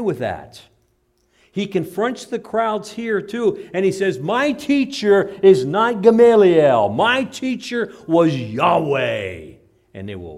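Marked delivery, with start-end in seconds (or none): 4.2-9.5 loud, slow, ringing proclamation; bold Jesus declaration. Retold or imitated. Jesus confronting the crowds, loud slow declaration.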